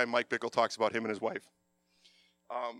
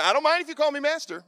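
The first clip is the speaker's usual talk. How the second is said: playful; 11 semitones higher, 5 dB louder than usual. Retold or imitated. Joking self-quote.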